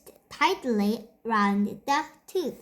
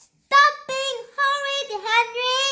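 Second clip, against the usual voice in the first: much higher, louder scolding shout; 13 semitones higher, 5 dB louder than usual. A narrator's reading. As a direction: shout across at naughty Henry. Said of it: "shouted Mom".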